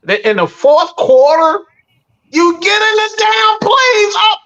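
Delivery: fed-up fan yelling about the two-minute offense; exasperated yelling. Real, not acted. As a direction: spell out the blunder in disbelief. Angry rant about late play call.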